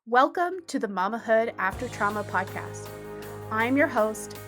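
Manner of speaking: ordinary talk, nothing marked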